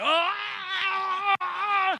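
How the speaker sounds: high, loud mock battle scream; Braveheart yell. Preacher imitating a Braveheart charge, high loud battle scream. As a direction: let out a charging warrior's scream. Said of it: "like Braveheart".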